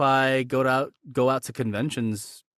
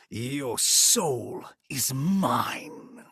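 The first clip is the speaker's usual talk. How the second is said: very slow, stretched villain line; drawn-out film version; 3 dB quieter, much slower than usual. Retold or imitated. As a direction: draw every word out. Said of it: "in nineteen ninety-five film, he goes".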